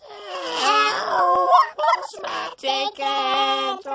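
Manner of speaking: squealing high mock wail, stretched out; octave-up impression. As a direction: shriek it as an exaggerated falsetto schoolboy. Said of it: "his voice is up an octave or so".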